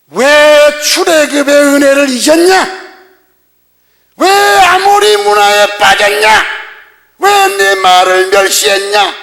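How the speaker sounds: very loud, slow, drawn-out prophetic shout; thundering rebuke. Retold or imitated. Prophet sent by God to reproach Israel, thundering rebuke. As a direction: roar each accusation, stretching the first word. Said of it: "이게 책망하잖아요".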